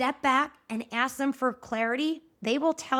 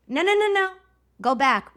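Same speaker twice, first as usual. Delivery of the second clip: insistent stop; 5 semitones higher, 5 dB louder than usual. Retold or imitated. Teacher stopping student deflecting compliment.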